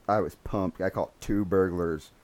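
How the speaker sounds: ordinary talk, nothing marked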